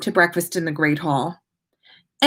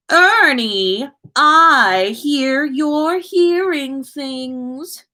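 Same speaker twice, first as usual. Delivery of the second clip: slow, higher, drawn-out teasing; mocking taunt; 7 semitones higher, 3 dB louder, much slower than usual. A narrator's reading. Draco teasing Ernie from the Slytherin table, lightly voiced. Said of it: "Draco said while chuckling".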